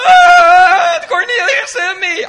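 loud, high-pitched wail; hammed-up crying child telling on someone; mock bawling. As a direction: wail it out like a kid. Acting himself bawling to teacher.

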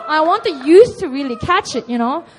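ordinary delivery

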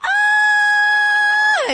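long, piercing high squeal; girls' reunion scream. Retold or imitated. Girls get together, imitating their loud excited scream. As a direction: scream loudly like girls meeting up. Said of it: "when girls get together, it's like".

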